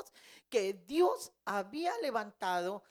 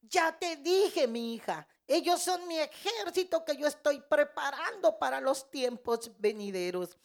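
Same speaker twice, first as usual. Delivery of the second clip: the Lord insisting the third time, pressing it hard; retold divine voice booming the reminder to his daughter; louder commanding voice; 5 semitones higher, 6 dB louder than usual. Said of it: "el Señor que me habla más fuerte".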